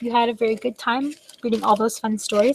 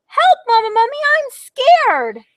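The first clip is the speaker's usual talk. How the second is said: much higher, loud frightened squeal; scared cry; 6 dB louder than usual. Acted. Baby mummy calling mother in fright, high squeal. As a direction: squeal for help like a scared little one.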